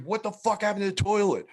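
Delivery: high-pitched mock bafflement; baffled cop. Cops finding the flooded cell, lightly mocked disbelief. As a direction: imitate a cop taken aback.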